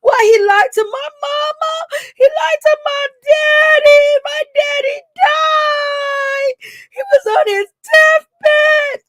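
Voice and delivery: over-the-top fake crying wail; mock crying. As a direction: ham up fake tears over her father. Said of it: "crying, like".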